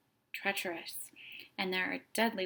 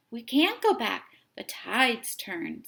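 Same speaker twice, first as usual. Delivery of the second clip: firm refusal; 3 semitones higher, 8 dB louder than usual. A narrator's reading. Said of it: "said one of the men".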